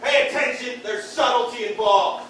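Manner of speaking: normal talking voice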